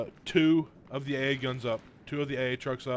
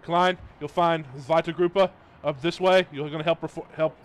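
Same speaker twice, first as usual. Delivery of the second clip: shouting orders; 4 semitones higher, 7 dB louder than usual. Real, not acted. Calling orders to a squadmate.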